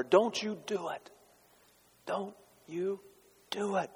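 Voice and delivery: high, hushed plea; don't you do it, begging softly; pleading warning. Acted. Preacher warning the congregation, high hushed plea.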